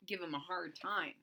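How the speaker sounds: plain reading voice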